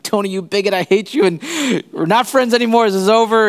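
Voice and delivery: high-pitched, hammed-up indignant rant; mock outrage. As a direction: play an offended friend cutting ties. Imagines friend's angry breakup speech.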